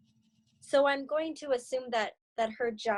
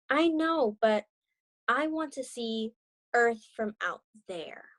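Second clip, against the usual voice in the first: gentle read-aloud storytelling; story narration. Acted. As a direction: read it like a children's picture book. Reads story with character dialogue.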